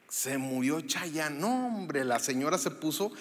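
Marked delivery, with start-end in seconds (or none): 0.1-1.4 soft, slow and low, mock solemn; somber news. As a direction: break the bad news gravely. Delivering fake sad news. (noticia triste, lenta y baja)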